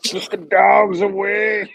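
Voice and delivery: high, loud drawn-out mock fan cry; stretched-out mock rallying yell; mimicking the shouting Steelers fan. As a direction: imitate a fired-up football fan, slow and loud.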